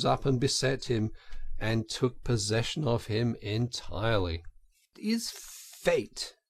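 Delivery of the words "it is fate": high, slow, resigned musing; brooding Toad. A narrator's reading. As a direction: Toad giving in to temptation. Toad to himself.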